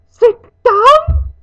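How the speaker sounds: very high, loud elf wail; shrieking, weeping disbelief; tearful wail. A narrator's reading. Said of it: "he wailed".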